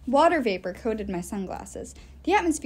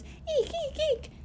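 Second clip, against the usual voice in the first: much higher squeaky little fish voice; tiny guppy squeaks; slower than usual. Acted. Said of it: "guppies whose entire thought process was".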